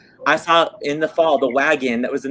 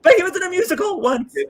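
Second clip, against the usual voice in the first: laughing mock quote. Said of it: "I'm like".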